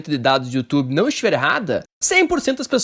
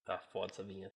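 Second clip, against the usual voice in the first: quiet aside; 11 semitones lower, 25 dB quieter, faster than usual. Real, not acted. Muttered aside after jingle.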